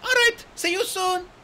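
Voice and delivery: put-on high, bright young dragonette voice; chirpy excited young dragon; eager little RainWing voice; Kinkajou's voice. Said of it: "Kinkajou called over her shoulder".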